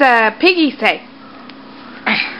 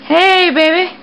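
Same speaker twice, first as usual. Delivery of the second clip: imitating baby's greeting, repeated; 5 semitones higher than usual. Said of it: "He say".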